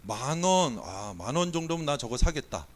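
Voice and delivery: ordinary talk, nothing marked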